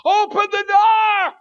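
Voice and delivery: high, loud, slow yell; desperate yell at the locked van door. Retold or imitated. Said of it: "I'm yelling".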